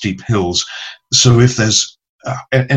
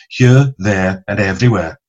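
broad Welsh vowels on each word; Welsh accent. Shown as a demonstration. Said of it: "the vital Welsh vowels".

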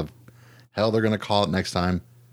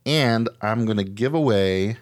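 ordinary delivery

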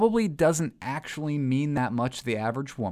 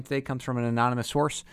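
ordinary talk, nothing marked

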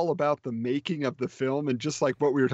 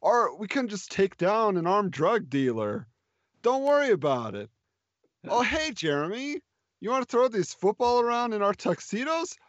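put-on higher, odd-accented Wiseau voice; Tommy Wiseau impression; 6 semitones higher than usual. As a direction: play the awkward melodramatic leading man. Parody of The Room dialogue.

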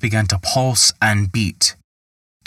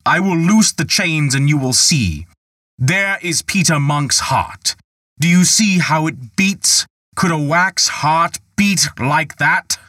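cried out; 7 semitones higher than usual. A narrator's reading. Irritated Michael cries out to prove his magic. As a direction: throw the door open and proclaim. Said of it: "and cried".

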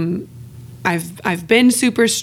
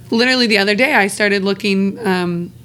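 normal talking voice

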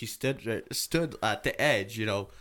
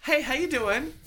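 imitated little kid; 3 dB louder than usual. Imitating brave kid greeting after scream.

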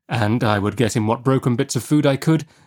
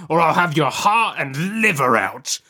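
high-pitched, escalating convict threat; menacing convict; 7 semitones higher than usual. Acted. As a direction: threaten with rising intensity, pitched well up.

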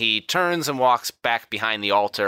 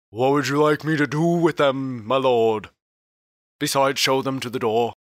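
bailiff Harry's voice; 5 semitones higher than usual. Acted. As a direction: play the obedient bailiff awaiting orders. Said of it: "Harry steps forward and goes".

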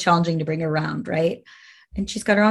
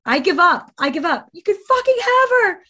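retold hysterical yelling, pitched way up; retold screaming; 8 semitones higher than usual. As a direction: scream it out at the end of your rope. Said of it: "screaming at the top of my lungs".

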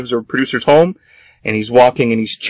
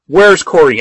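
mock yell, higher and louder; retold scream; 6 semitones higher, 5 dB louder than usual. Kat repeating her question to Clay, retold scream. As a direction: press the question in an imitated shout. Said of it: "Kat just keeps screaming at him".

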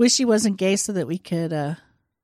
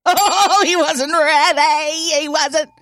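high, loud and slow teasing; 7 semitones higher, 5 dB louder, slower than usual. Real, not acted. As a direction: tease him in a high sing-song. Teasing after cut, high loud.

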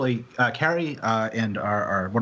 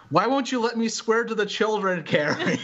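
higher-pitched imitation of a whiny protest; mock exasperated plea; 9 semitones higher than usual. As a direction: plead in playful mock outrage. Retold outburst of protest to Carrie.

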